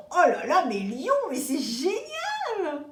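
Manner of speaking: high, loud gushing compliment, slowing on the second line; gushing praise. Retold or imitated. People raving to the Lion about their work, gushing. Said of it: "vont te dire".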